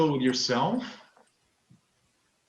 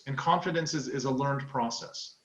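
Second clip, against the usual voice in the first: usual speaking voice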